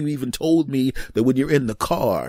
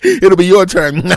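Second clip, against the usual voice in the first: gloating laugh; 9 semitones higher, 11 dB louder, slower than usual. Real, not acted. Mocking young listeners, laughing.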